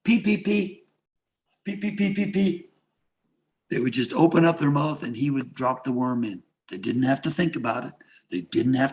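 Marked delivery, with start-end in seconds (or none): 0.0-2.7 high, chirpy cheeps like hungry hatchlings; baby bird chirps. Retold or imitated. Sensei mocking his own students as baby birds, high chirping cheeps. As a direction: peep like a baby bird begging for food. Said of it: "they were baby birds".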